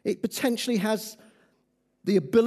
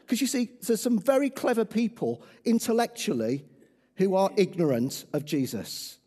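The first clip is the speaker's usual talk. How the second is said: normal talking voice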